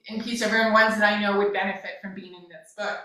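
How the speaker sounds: ordinary talk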